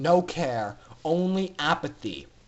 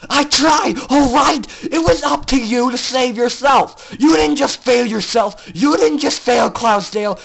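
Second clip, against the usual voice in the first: loud, higher, cold furious shout; renewed fury; 5 semitones higher, 7 dB louder than usual. Acted. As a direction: condemn her with every word. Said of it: "remembering her anger suddenly".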